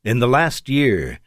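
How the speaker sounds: ordinary delivery, nothing marked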